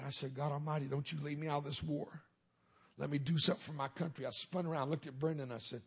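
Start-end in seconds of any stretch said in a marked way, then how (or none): none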